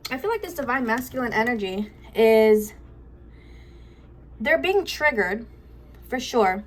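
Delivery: breathing hard after the stairs; winded, short of air. Real, not acted. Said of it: "I'm out of breath".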